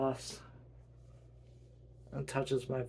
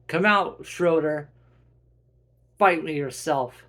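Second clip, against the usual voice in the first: loud challenge to an enemy; shouted-out dare; calling out; 3 semitones higher, 11 dB louder than usual. Acted. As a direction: call the foe out, raised voice.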